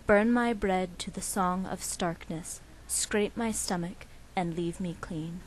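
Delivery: measured, emphatic poem recital; poetry reading. Acted. Student poet reading her poem Miriam to interviewer, measured recital. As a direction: deliver each image deliberately, leaning hard into line openings. Reads own poem aloud.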